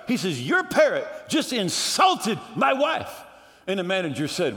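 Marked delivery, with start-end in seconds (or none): 0.4-3.1 high-pitched outraged complaint; offended customer, voice raised; indignant husband. Acted. Acted husband complains to manager.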